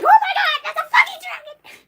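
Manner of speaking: high, loud mock-terrified squeal; panicked shriek. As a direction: shriek it like a cartoon character fleeing. Acting two characters fleeing a dragon.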